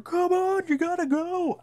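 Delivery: very high, strained urging call; urgent calling out. Retold or imitated. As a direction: hurry someone along in a high-pitched cry. Urgent call while running to elevator.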